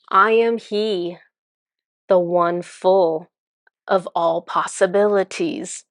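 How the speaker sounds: slow, grand poem with long pauses; self-important verse, stretched word by word; reciting a rhyme. Retold or imitated. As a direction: intone it like an ancient prophecy, drawn out. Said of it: "he starts talking in rhyme".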